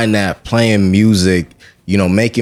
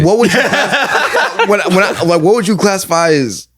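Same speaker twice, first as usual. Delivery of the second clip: laughing; 10 semitones higher than usual. Real, not acted. Laughing at own blank.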